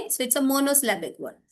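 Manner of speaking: normal talking voice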